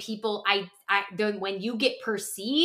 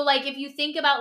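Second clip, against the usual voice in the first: usual speaking voice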